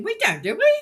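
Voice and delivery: high, loud lilt rising on "do we?"; imitated Australian sing-song. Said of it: "bad habit of singing while they speak".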